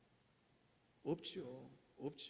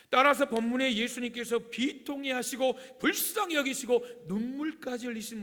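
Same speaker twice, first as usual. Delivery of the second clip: loud, forceful pulpit preaching; 7 semitones higher, 11 dB louder than usual. Real, not acted. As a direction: preach it out with raised, insistent voice. (힘주어 외치는 설교)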